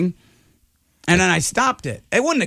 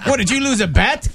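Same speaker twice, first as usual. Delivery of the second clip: ordinary delivery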